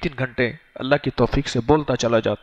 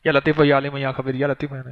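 rushing through "या लतीफ हो, या अलीम हो" mechanically without feeling; rattled-off recitation; faster than usual. Shown as a demonstration. Preacher mocking the wrong way to read the tasbih, rushed. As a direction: gabble the names quickly like a rote chore. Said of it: "ना, ऐसे ना पढ़ना".

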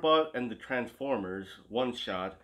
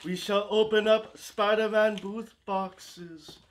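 pope proclamation; 8 semitones higher, 6 dB louder, slower than usual. Pretend.

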